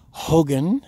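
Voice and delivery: usual speaking voice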